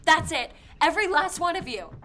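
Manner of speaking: high, very loud outburst of dismissal; angry, full-voiced yelling; furious shout. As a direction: shout them all out of your sight. Drives the listeners away angrily.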